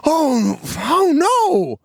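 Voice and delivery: hammed-up shocked cry; alarmed oh no. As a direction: play it like terrible news just arrived. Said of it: "and she'll go".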